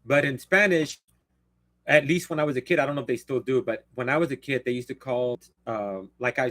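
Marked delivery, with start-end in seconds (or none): none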